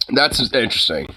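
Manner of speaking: ordinary talk, nothing marked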